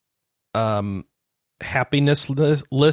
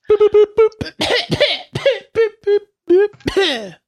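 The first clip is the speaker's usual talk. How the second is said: censor bleep; 4 dB louder, slower than usual. Pretend. Jokey bleeps over coughing fits.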